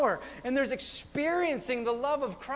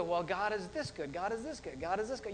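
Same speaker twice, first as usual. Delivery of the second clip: quiet, lower quoted voice; understated mimicry; 4 semitones lower, 6 dB quieter than usual. Preacher mimicking people limiting God's goodness, understated. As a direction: play it small and soft.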